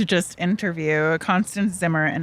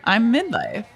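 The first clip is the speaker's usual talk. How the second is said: high-pitched, incredulous self-questioning; mock bewilderment; 7 semitones higher than usual. Host quoting her own midlife reaction, mock bewilderment. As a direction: act out baffled disbelief, pitch leaping up. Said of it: "I always go like".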